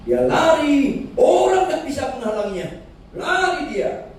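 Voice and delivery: fervent pulpit preaching. Acted. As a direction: preach the parable with fervour. Preaching at full force.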